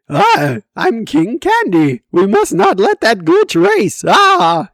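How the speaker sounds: high, loud cartoon king voice, lisping and bouncy; King Candy impression. Shown as a demonstration. Vlogger shows off his King Candy voice, high and loud. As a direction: play the candy king from Wreck-It Ralph. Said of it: "that's the voice I can do".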